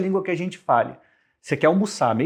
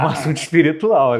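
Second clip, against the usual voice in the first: laughing; 7 dB louder than usual. Real, not acted. Laughing at the provocation.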